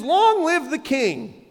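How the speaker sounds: high, drawn-out royal acclamation; herald-like cry with a long first word; mock proclamation. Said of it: "he explained".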